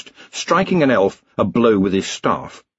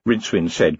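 ordinary delivery

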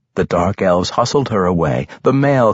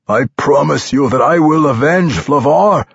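raised, forceful character voice; vengeful vow; 5 semitones higher, 4 dB louder than usual. A narrator's reading. Drider swearing vengeance into Dahlia's face, vengeful. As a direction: swear revenge, high and intense.

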